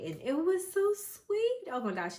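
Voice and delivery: gushing. Real, not acted. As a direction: melt over how sweet it was. Remembering baby's first cry.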